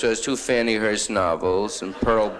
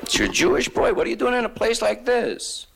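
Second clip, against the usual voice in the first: put-on delighted old man; 12 semitones higher than usual.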